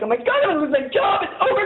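reliving the fear of losing the job; high frantic wail; panicked. Retold or imitated. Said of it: "I started crying".

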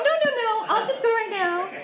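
forced breezy insistence. Retold or imitated. Refusing boyfriend's offer to stay, mock breezy no. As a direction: brush it off with a laugh.

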